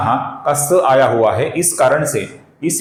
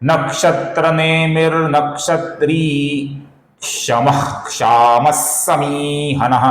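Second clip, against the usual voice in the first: slow measured verse chant; slow Sanskrit shloka chant; shloka recitation; slower than usual. Shown as a demonstration. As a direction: recite slowly, syllable by syllable. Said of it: "दूसरी पंक्ति का उच्चारण देखते हैं".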